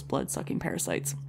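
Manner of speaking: whisper. Acted. Punchline dropped low.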